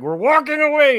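high, loud, strained announcement; retold insistent dad. Dad dragging his daughter through the store, loud strained insistence. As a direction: call it out while hauling a clinging child. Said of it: "I'm walking, trying to, like".

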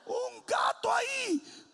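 high, drawn-out startled cry; mock fright. Preacher re-enacts spotting a cat, high mock fright. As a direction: wail it in comic alarm, slowly.

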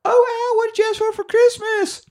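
put-on very high falsetto child voice; cute little kid. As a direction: squeak it like an eager little girl. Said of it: "cute little kid".

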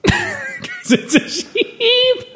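very high, drawn-out sheepish bleat; silly catchphrase punchline. Pretend. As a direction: stretch it out in a silly squeal.

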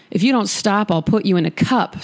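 normal talking voice

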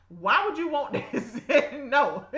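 loud, slow, laughing disbelief. Real, not acted. Preacher questioning why anyone wants prophecy, laughing loudly. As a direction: laugh through the words, drawn out and loud.

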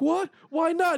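mock shocked squeak. Richard reacting to Drax's threat, high shocked squeal. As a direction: squeal it in disbelief.

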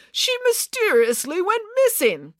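high, drawn-out self-justification; defensive protest. Acted. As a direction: insist on your innocence slowly. Accused man defends himself.

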